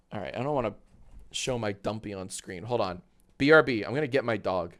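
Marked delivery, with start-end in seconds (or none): none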